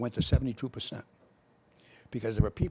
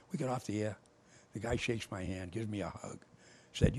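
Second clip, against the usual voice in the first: ordinary delivery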